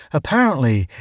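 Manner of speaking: plain reading voice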